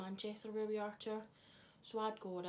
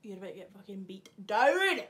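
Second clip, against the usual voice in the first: playful loud yell, pitched up; mock threat; 12 dB louder than usual. Vlogger threatening heckling friend, mock yell. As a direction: burst out loud with a joking threat.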